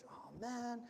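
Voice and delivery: deflated groan at the catch; disappointed. Acted. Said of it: "he'd look at it disappointedly".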